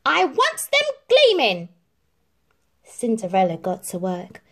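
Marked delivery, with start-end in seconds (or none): 0.0-1.7 high, loud commands; ordering stepmother. A narrator's reading. Stepmother orders Cinderella, high loud commands. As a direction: bark out the chores. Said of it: "ordered the stepmother".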